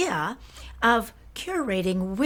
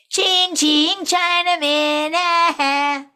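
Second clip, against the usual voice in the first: put-on higher, louder jeering boys' chant; mocking taunt; 9 semitones higher, 6 dB louder, slower than usual. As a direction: imitate boys jeering from bicycles. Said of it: "rode by and shouted".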